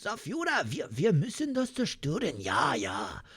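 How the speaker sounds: high, soft mock German rant; German accent. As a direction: strain for a German accent in a squeaky register. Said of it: "do a German accent".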